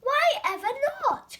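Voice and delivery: high, loud dismayed cry; cried out. A narrator's reading. Squirrel protests the bird leaving, crying out. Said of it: "cried Squirrel".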